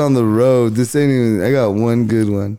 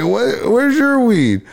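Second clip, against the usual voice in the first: mock astonishment; 11 semitones higher, slower than usual. Others asking famous stoner for weed.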